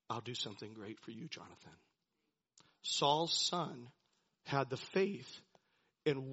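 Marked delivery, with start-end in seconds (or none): 0.1-1.8 very low, soft voice of God; quiet, intimate assurance; hushed promise. Retold or imitated. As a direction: murmur it as a personal promise. God's promise to Jonathan.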